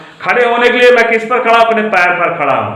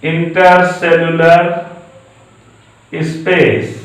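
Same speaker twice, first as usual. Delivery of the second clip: Intercellular drawn out very slowly, then space set apart after a pause; teacher dictating intercellular space slowly for the class; slow careful term; 3 semitones lower, much slower than usual. Shown as a demonstration. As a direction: spell the term out slowly so students catch it. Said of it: "ध्यान से देखिएगा".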